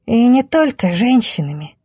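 bashful, thin-voiced bandit; shy thin voice. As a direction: pipe up shyly from the crowd. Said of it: "застенчиво поправил тонкий голос".

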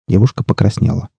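ordinary delivery, nothing marked

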